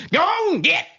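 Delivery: high sing-song go on, get; playful high-pitched shooing call; shooing the kids away. Retold or imitated. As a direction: shoo them off in a high singsong. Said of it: "I literally get 'em".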